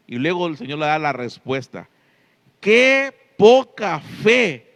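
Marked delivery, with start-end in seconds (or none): 2.6-4.7 loud, slow, higher exclamation; scolding rebuke. Acted. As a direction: drag each word out as a stern reprimand. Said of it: "yo diría hasta como un regaño".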